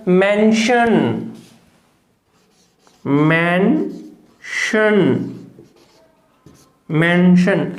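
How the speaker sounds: Mention stretched out very slowly; slow demo. Shown as a demonstration. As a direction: drag out mention for the learners. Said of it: "pronunciation बताइएगा क्या होगा?". (Mention बहुत धीरे धीरे)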